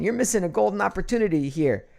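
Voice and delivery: ordinary delivery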